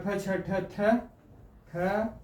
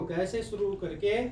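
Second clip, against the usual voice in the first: normal talking voice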